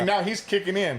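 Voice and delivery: ordinary talk, nothing marked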